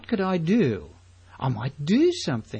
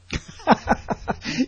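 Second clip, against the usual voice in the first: short laugh; 10 semitones higher than usual. Real, not acted. Amused at his own question.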